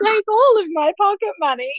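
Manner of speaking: hammed-up teary pity for a brother; mock gushing sympathy. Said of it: "and I'd just be like".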